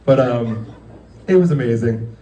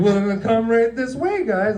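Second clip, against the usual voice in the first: high, sing-song welcome to the dog; mock host welcome; 12 semitones higher than usual. Waiter seating guests while talking only to their dog, mock welcome. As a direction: greet the dog like the guest of honour.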